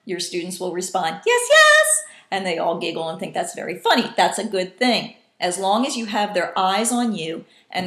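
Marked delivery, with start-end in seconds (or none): 1.2-2.0 very high, loud double reply; high-pitched. Shown as a demonstration. Students echoing the teacher's high call, high-pitched. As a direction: squeal both words at the top. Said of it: "in a high-pitched voice".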